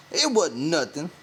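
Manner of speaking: plain reading voice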